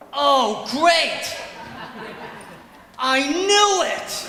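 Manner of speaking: sarcastic driver fuming; angry other driver. Retold or imitated. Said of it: "other guy getting out of his car going".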